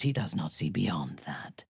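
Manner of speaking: low, soft voiceless whisper; conspiratorial low whisper. Acted. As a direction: drop to a secret whisper. Conspiratorial aside.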